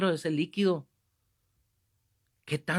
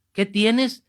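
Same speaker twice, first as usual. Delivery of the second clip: high, raised voice demanding a repeat; loud acted questioning; 6 semitones higher, 8 dB louder than usual. Priest who did not catch the whisper, loud acted question. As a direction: blurt it out, missing the secrecy.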